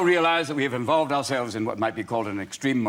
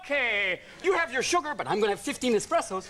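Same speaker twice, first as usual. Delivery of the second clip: mock kids' voices; 12 semitones higher than usual. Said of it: "And they go".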